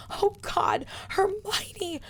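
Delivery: very high crying cry that falls away; a girl choking on her tears to her friend; gasping sob. Acted. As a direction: wail the name through sobs. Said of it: "Ginny gasped".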